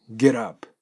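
"ゲットアップ" linked as geh-rap with a tapped D; American-style linked "ゲットアップ"; soft D liaison. Shown as a demonstration. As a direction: flap the T in "ゲットアップ". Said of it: "ラに点々ですね".

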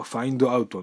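"find out" pronounced fa-in-do a-u-to; "find out" unlinked, in katakana syllables; katakana English. Shown as a demonstration. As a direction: say "find out" with Japanese vowels after the consonants. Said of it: "カタカナ英語だと".

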